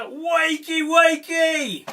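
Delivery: high, drawn-out wake-up call; group call-out yell. Shown as a demonstration. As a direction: yell it out for the children to join in. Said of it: "we're all gonna yell together".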